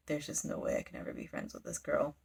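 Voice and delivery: low, soft, resigned aside; glum inner thought. Retold or imitated. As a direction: drop it low and quiet, to yourself. Character's glum private thought, retold.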